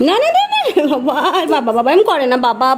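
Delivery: laughing through the words, pitch jumping very high; playful laughing scold. Real, not acted. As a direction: giggle out the scolding. Laughing while stopping the child.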